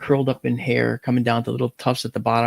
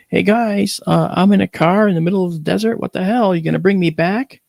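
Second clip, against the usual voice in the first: higher, raised voice calling after someone; retold plea, pitched up and projected; 6 semitones higher, 4 dB louder than usual. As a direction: call after people who are leaving. Calls after people walking away.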